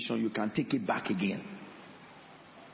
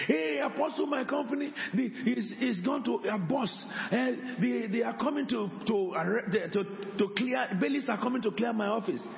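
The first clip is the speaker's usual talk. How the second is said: desperate plea; 9 semitones higher than usual. Retold or imitated. Man retells desperate plea.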